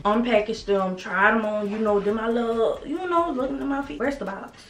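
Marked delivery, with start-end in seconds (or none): none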